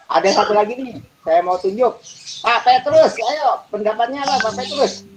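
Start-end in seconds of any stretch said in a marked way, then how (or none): 2.4-5.1 high-pitched summons, prodding for an answer; calling on a student. Real, not acted. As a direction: call the student up in a raised pitch. Dosen memanggil mahasiswa untuk menjawab. (memanggil mahasiswa dengan nada tinggi)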